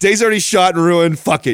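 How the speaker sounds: worked-up retelling of a ruined day; retold fury. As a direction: rant it like the fury is back. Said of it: "I was so fucking mad".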